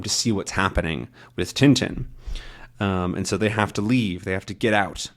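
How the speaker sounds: usual speaking voice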